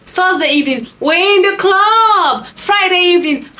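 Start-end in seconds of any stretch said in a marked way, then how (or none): 1.0-2.5 high, loud club holler, drawn out; mock a clubber calling out in excitement; always-outside party guy. Party man reporting his Thursday evening, loud high mocking call. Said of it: "those men that are always outside".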